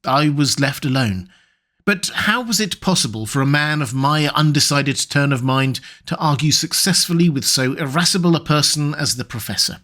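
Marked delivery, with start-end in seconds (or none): none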